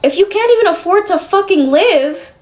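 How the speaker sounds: retold challenge, pitch rising line by line; exasperated confrontation. Daughter retelling how she confronted her mother over the casino, exasperated.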